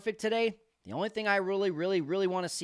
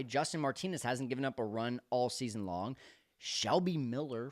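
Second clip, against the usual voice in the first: normal talking voice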